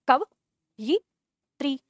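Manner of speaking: "कवयित्री" pronounced very slowly, syllable by syllable. Shown as a demonstration. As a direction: break "कवयित्री" into pieces, slowly. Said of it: "आपने क्या लिखा है?". ("कवयित्री" धीरे-धीरे टुकड़ों में)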